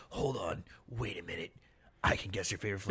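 hushed mystic voice. Retold or imitated. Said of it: "the ice cream whisperer".

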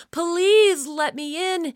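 high, loud, slow desperate plea; frightened child pounding at a door; pleading cry. A narrator's reading. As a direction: beg to be let in before it's too late. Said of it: "Sam cried".

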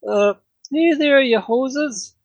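high-pitched Canadian pub keeper; hammed-up Canadian innkeeper greeting guests; mock Canadian accent. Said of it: "And he had an accent".

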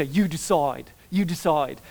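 rough imitation of an Australian TV host; half-hearted Australian accent; attempted Australian accent. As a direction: have a go at an Australian accent, not convincingly. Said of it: "I can't do a good Australian accent".

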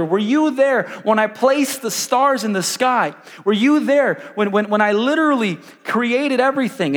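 loud, insistent divine interrogation; God challenging Job. Acted. God demanding answers from Job about creation, loud challenge.

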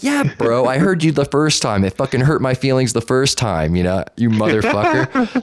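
high-pitched, aggrieved comeback; mock indignant. Answering the opponent's repeated taunt, mock indignant. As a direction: play up the wounded pride, half-joking.

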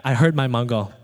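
ordinary talk, nothing marked

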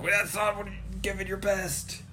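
high, exasperated friend; scolding friend. Retold or imitated. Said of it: "And Reach is like".

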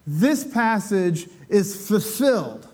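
slow, weighty pronouncement; Jesus slowly declaring the passage fulfilled; solemn declaration. Acted. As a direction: let it land slowly, like a proclamation. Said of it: "And Jesus says".

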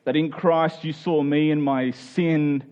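ordinary delivery

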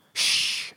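long unvoiced "shh"; hissed shushing noise; sharp shush. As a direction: hush someone mid-sentence. Character hushes the narrator mid-sentence.

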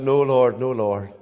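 soft, sinking, pleading with God; a hushed retelling of a son's grief; grief-stricken plea. Retold or imitated. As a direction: let the words fall away in sorrow. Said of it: "and I said".

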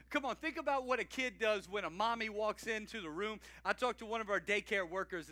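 usual speaking voice

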